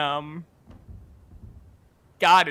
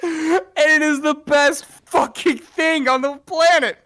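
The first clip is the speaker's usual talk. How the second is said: laughing; 8 semitones higher, 4 dB louder, slower than usual. Real, not acted. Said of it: "laughing through excitement".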